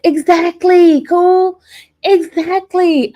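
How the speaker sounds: loud, high agreement; loud, high emphatic exactly. Real, not acted. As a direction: hit it hard, she agrees.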